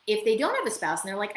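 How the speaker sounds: ordinary talk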